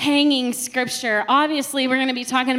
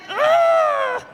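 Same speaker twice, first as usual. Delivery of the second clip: high, sharp "Ah" burst out; sudden exasperated shout. Acted. Mother fed up that nobody listens, sharp exasperated cry. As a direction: yelp it in mock frustration. Mock yell about not listening.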